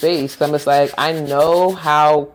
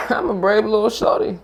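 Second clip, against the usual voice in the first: laughing; 5 semitones higher than usual. Real, not acted.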